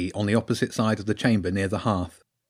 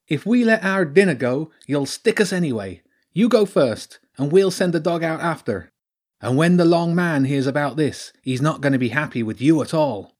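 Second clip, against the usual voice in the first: raised, taunting polecat gang-leader voice; sneering polecat villain; 5 semitones higher, 3 dB louder than usual. Acted. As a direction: threaten as a cocky villainous creature.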